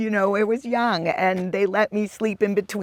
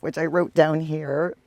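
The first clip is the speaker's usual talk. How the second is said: normal talking voice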